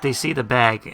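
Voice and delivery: ordinary talk, nothing marked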